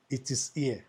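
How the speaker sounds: ordinary talk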